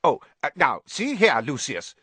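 high, loud, flustered protest; rattled official objecting; alarmed. A narrator's reading. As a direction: sputter in alarm. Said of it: "looking alarmed".

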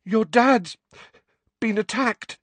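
high, breaking off for breath; panting alarm. A narrator's reading. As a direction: gasp out urgent bad news. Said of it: "he panted, his chest heaving".

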